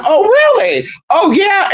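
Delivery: high mock-surprised reaction; imitated surprise. Police hearing grandma's account, high imitated surprise. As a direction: lift it way up, acting out astonishment.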